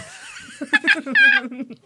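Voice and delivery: high, loud wheezing laugh; squealing real laughter; real burst of laughter. Real, not acted. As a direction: crack up helplessly.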